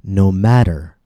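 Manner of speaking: "matter" with the T as a quick, light D; tapped T. Shown as a demonstration. Teacher quoting a sung line for learners, tapped T in "matter". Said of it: "This pronunciation of T, like a quick and light D".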